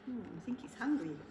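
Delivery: quick whisper to the listener; whispered aside. Real, not acted. The reader whispers an aside to the child. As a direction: whisper it as a secret.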